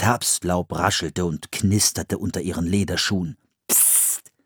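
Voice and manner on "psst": soft, voiceless shush; shushing hiss. A narrator's reading. Boy hushing his sister in the woods, soft shush. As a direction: hiss softly for silence. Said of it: "machte Philipp".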